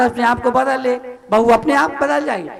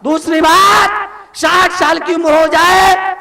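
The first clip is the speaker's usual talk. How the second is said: high, forceful sermon shout; preaching at full force; 7 semitones higher, 6 dB louder than usual. Real, not acted. Monk urging families to change themselves, full-force preaching. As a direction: exhort them in long, raised cries.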